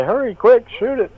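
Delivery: high-pitched urgent call, held-back volume; half-yell it high and urgent; mock yell. Player urging a teammate to shoot, urgent mock yell. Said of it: "I wanna yell out and say".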